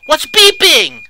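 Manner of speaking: high, loud, frantic yell; panicked shout. Real, not acted. Player hearing an alarm in the kitchen, shouting in panic. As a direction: demand to know what's beeping, close to panic.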